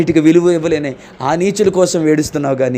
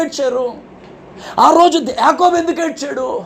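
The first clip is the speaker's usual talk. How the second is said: fiery preaching; 12 semitones higher than usual. Acted. Pastor thunders questions at the congregation, fiery preaching. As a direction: hammer each question at the congregation.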